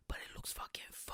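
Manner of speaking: hushed, breathy whisper; conspiratorial whisper. Pretend. Comedian admitting rioting looks fun, whispered. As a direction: whisper it like a guilty confession.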